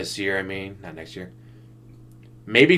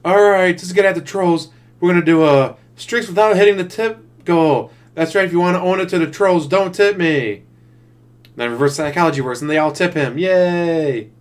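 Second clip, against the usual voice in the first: excited streamer voice, louder and higher; DSP; 6 semitones higher, 4 dB louder than usual. Retold or imitated. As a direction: hype up the stream like an eager host. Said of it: "That'd be his next thing".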